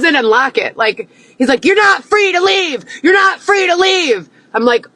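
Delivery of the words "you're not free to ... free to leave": very high, barked cop order; shouting cop command; imitated cop shout. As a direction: mimic a cop yelling at her.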